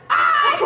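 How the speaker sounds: high, loud squeal; mock toddler cry. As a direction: yelp it like a tot, in fits of mirth. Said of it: "left crying and say".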